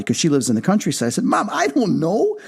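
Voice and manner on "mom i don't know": high-pitched protest to mom; exasperated protest. Retold or imitated. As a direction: act out a worked-up, hurried defence. Retells protest to his mom.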